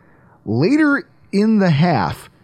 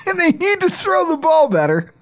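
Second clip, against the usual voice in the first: high-pitched, breaking into laughter; laughing rant; 13 semitones higher than usual. Real, not acted. Podcaster ranting about Mac Jones, high laughing complaint. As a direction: push the complaint out through laughs.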